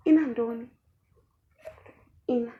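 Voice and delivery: indignant yell; indignant shout. Retold or imitated. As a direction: throw it back at him in disbelief. Outraged reply to the guy.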